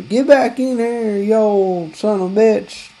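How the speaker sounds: high, mock yell after him; retold yell. Clerk yelling after the customer leaving the store. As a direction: call a customer back, half-hearted. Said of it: "he's yelling at me".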